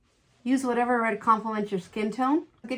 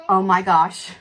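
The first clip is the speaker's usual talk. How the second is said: genuine delight; 7 dB louder, slower than usual. Real, not acted. Testing new liner brush live.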